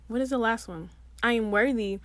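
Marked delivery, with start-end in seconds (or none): none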